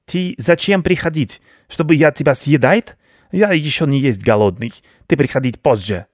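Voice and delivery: imperious rodent baron; gruff-tempered rat baron. Acted. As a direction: play a haughty rat sending a visitor away.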